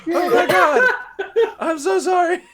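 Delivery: panicked apology. Retold or imitated. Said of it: "And I was like".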